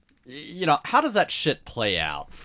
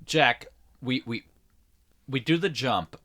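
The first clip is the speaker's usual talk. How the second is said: mock direction; 6 semitones higher than usual. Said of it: "it's like".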